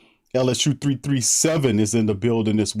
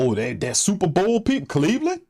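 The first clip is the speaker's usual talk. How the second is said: high sarcastic rise on Cleveland; mock the pundits; scoffing imitation of the hype; mocking quote; 7 semitones higher than usual. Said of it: "them lying people talking about".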